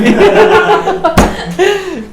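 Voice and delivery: loud high burst of laughter. Real, not acted. Teacher laughing at the angry wife story, loud laugh. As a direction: crack up at the story.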